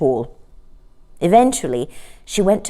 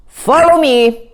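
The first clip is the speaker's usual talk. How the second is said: king's voice; 6 semitones higher, 6 dB louder, slower than usual. A narrator's reading. King leads them out.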